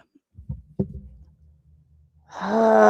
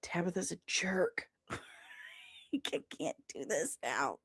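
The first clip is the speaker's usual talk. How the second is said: whisper; 4 semitones lower, 16 dB quieter than usual. Real, not acted. Whispered aside about friend.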